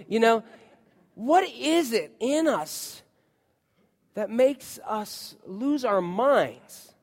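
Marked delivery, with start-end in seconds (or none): none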